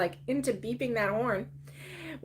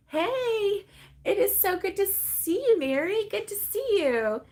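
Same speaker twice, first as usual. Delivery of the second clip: high, sing-out welcome; real, pleased hello in raised pitch; delighted greeting; 9 semitones higher than usual. Real, not acted. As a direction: greet a friend you're happy to see. Greets viewers arriving in the chat.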